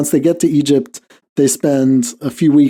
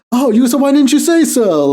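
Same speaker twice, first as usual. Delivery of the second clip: much higher, loud, a different voice, suddenly all smiles; mock sudden friendliness; 12 semitones higher, 3 dB louder than usual. Theater crowd to the Yugoslav in uniform, higher, mock-surprised welcome. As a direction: play the crowd's instant turnaround, brightly surprised.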